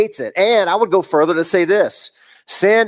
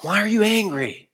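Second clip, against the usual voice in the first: tender stooping voice; 4 semitones higher than usual. Acted. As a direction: speak like a nurse to a child. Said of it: "He speaks with a lisp".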